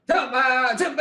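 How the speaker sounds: loud, high burst of nonsense syllables; shouted gibberish. Pretend. Player using only gibberish to convey clues, shouted. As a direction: yell the nonsense at high pitch.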